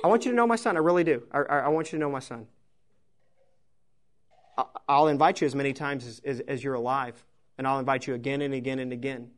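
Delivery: a quiet plea to know his son; gentle God's invitation. Retold or imitated. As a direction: invite them tenderly, over and over. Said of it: "He says".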